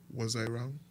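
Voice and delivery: ordinary talk, nothing marked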